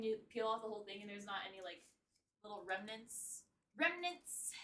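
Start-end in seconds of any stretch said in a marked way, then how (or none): 3.8-4.5 sudden higher, aggressive repeat. Pretend. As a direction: punch the word out high, mock-aggressive. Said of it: "That was aggressive".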